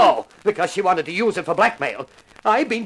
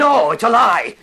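angry outburst; 2 semitones higher, 6 dB louder, slower than usual. Acted. Accused man bursts out at accuser.

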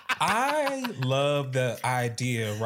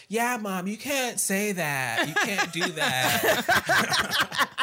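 higher, slow, drawn-out scolding; kids scolding mom; 7 semitones higher, much slower than usual. Retold or imitated. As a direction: spell it out like to a clueless parent. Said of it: "They were like".

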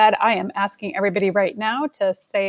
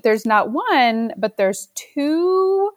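excited announcer build-up; 6 semitones higher, slower than usual. Real, not acted. Announcing two guests.

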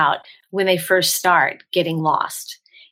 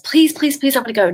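higher-pitched, eager mock pleading; lightly acted childhood begging; begging child; 6 semitones higher than usual. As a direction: plead like a kid working on parents. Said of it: "I begged my parents".

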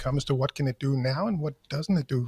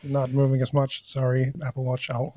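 ordinary delivery

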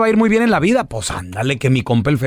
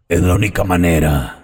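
raspy devil; 12 semitones lower than usual. Acted. Devil appears to whoever summoned him, deep raspy voice. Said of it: "Qué voz tan rasposa".

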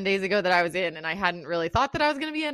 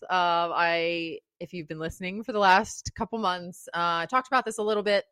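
ordinary delivery

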